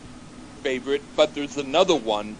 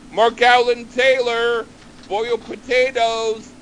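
calling to dinner; 7 semitones higher, 3 dB louder, slower than usual. Retold or imitated. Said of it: "someone called you for dinner".

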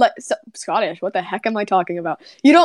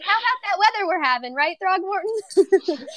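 higher, sudden then dragged out weather chat; awkward small talk; 9 semitones higher, 4 dB quieter than usual. Retold or imitated. Druid making awkward small talk with the bartender. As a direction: blurt the small talk, then stall on the name. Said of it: "Rowan's just like".

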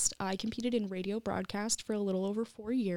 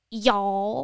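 "Y'all" drawn out loud and rising; mock Southern accent; 8 dB louder than usual. Classmates pointing out the guest's accent, mock Southern. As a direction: mimic teasing of a Southern "Y'all". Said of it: "people would be like".

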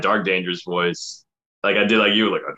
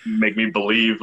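usual speaking voice

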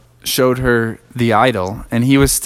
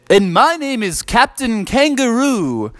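silly dad voice; 11 semitones higher than usual. Acted. Crazy dad names himself.

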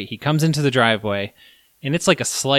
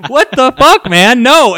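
much higher, louder outburst of disbelief; panicked yell; 12 semitones higher, 12 dB louder, slower than usual. Retold or imitated. Storyteller reliving his alarmed shout at the driver. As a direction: shout it like you can't believe him. Retelling panicked refusal to help.

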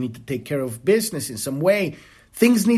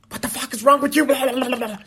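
sharply higher, imitating someone lashing out; mock angry outburst; 9 semitones higher, much faster than usual. Speaker acting out lashing back at someone who hurt them, mock angry outburst. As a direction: snap back like you're picking a fight.

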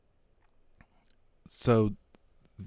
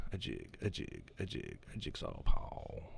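much lower, slow, whispered growl; deep growled whisper; 10 semitones lower, 8 dB quieter, slower than usual. Pretend. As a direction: rumble it like a wrestling villain. Repeats name chant, deep.

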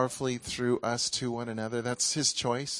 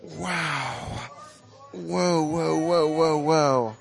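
preaching at full force, louder and higher, slow and drawn out; fervent prayer; 3 semitones higher, 6 dB louder, much slower than usual. Real, not acted. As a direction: cry out to heaven for the glory to fall. Prayer fading to hush.

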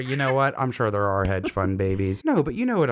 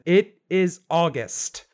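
slow, emphatic, higher-pitched retort; fed-up correction; 6 semitones higher, much slower than usual. Retold or imitated. Customer answering a man who always asks about the new job, exasperated. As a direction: draw out each word in exasperation.

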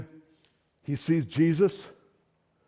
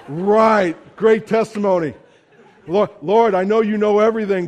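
louder, laughing, sarcastic protest; exasperated Peter; 3 semitones higher, 7 dB louder than usual. Retold or imitated. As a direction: throw up your hands at the absurd assignment. Peter protesting his new job.

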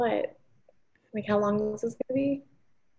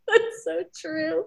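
laughing; 10 semitones higher than usual. Real, not acted. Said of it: "laughing after friend's joke".

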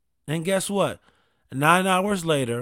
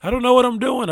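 high, loud panicky cry of confusion; hammed-up flustered panic, voice pitched up; mock panic in a high, loud voice; panicked whine; 8 semitones higher, 6 dB louder than usual. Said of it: "I'm like".

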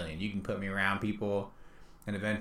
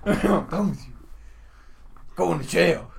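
mock outburst; 9 semitones higher, 6 dB louder, slower than usual. Repeating the running joke.

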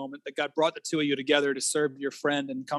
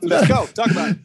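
louder, higher push to get on with it; real mock impatience; impatient; 8 semitones higher, 7 dB louder, faster than usual. Real, not acted. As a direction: come on, just say it. Host urging him to start.

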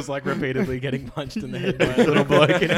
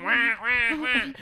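mock honking wah; 8 semitones higher, much slower than usual. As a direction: honk it out like a bird head.

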